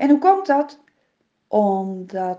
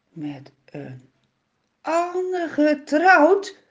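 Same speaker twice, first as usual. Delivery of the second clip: higher, slow stunned echo; shocked disbelief; 5 semitones higher, 3 dB louder, slower than usual. A narrator's reading. Simson repeats the father's news in higher, slow disbelief. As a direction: Simson can't believe his ears. Simson hears wife is remarried.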